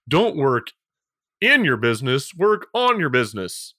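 mock-solemn slogan, punching IN and ON; sarcastic cliché. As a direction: recite it like an eye-roll catchphrase with weighty pauses. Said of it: "I'm being a little, uh, sarcastic there".

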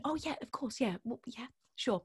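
very soft, flustered yes; meek agreement. Retold or imitated. Auditionee interrupted by the director, meekly agreeing. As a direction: agree under your breath, near whisper. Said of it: "And I went".

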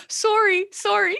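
"Sorry" high, with a rounded Canadian "SOR-ee"; cute mock-Canadian "Sorry", vowel as in "sore"; Canadian accent. Teasing a Canadian co-host, playful Canadian accent on "Sorry". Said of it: "the cute Canadian accent".

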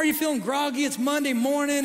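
usual speaking voice

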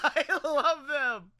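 laughing, slow, delighted. Real, not acted. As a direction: laugh through it gleefully.

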